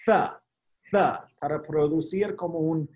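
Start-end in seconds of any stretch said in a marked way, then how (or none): 0.1-1.2 "Th" with the tongue against the upper teeth; th sound. Shown as a demonstration. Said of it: "tenemos que tocar la lengua con los dientes".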